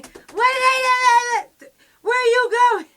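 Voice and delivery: very high, very loud, drawn-out shriek; frantic scream. Retold or imitated. Girlfriend screaming as she is taken back to her cell. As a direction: scream it in panic through the wall.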